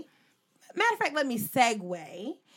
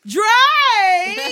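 loud, high excited call; excited shout; 10 semitones higher, 13 dB louder than usual. Real, not acted. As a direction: yell the name out with excitement. Excited about the party song.

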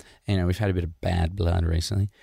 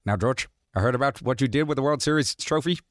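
lightly voiced boss, pitched up; a hint of a blustering team owner; Steinbrenner voice; 4 semitones higher than usual. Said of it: "brings George in and he's like".

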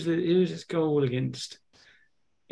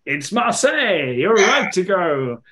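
high, loud announcement of the right answer; raised, excited quiz-host reveal; 5 semitones higher, 6 dB louder than usual. Real, not acted. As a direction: burst out with the good news. Revealing the friend's correct answer.

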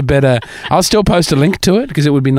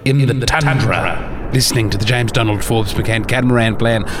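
dramatic documentary narration. Acted. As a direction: narrate it like an epic nature film. Grand documentary-style recorded narration.

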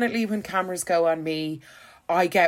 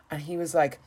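normal talking voice

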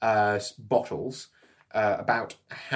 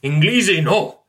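higher, louder panicky "Inglesi, no!"; frantic refusal; 7 semitones higher, 9 dB louder than usual. Acted. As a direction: wave the English away in alarm. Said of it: "speaking very rapidly in Arabic".

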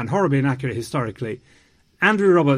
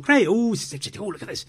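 mock excited gush; 5 semitones higher, much faster than usual. Retelling their own excited reactions.

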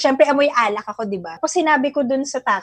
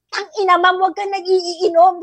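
mild mock anger; 5 semitones higher, 4 dB louder than usual. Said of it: "grabe talaga yung galit niya".

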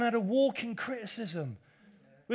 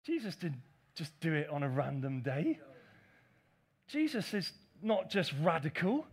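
ordinary delivery

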